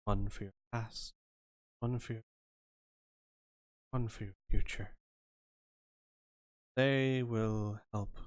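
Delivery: measured card reader with frequent pauses; softly spoken, deliberate Zelura; lightly voiced fortune teller. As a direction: quietly explain the reading, leaving long pauses.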